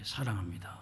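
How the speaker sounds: soft, quiet, tender; tender declaration. Shown as a demonstration. Preacher modelling a loving word to the congregation. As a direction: say it softly from the heart. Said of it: "표현하셔야 돼요".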